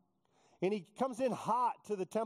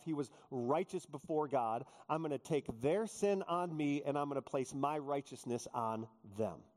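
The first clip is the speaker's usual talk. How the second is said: ordinary delivery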